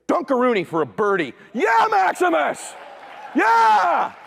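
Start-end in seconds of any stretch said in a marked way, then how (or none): 1.5-4.1 high, drawn-out triumphant shout; jubilant cheer. Retold or imitated. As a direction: whoop like a proud dad after a birdie. Cheers son's birdie.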